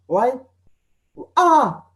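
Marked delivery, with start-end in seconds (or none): 1.4-1.8 sudden high, loud pain cry. Shown as a demonstration. Teacher demoing the cry of stepping on a thumbtack. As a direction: yelp like you stepped on a tack. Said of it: "because you'll be feeling pain".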